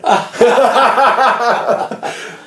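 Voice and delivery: loud, high burst of laughter; big laugh. Real, not acted. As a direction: crack up at the memory. Laughing at his own story.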